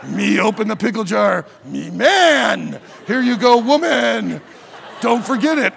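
loud grunting caveman. Acted. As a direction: boast like a caveman. Caveman husband opening jar.

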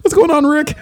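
very high cartoon kid voice; Morty. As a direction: squeal it like a nervous cartoon sidekick. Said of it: "Morty sounds like that".

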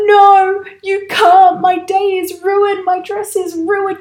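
a bride in despair, loud then wilting; imitated desperate cry. Bride refusing to get out of the car, loud despairing cry. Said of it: "She's going".

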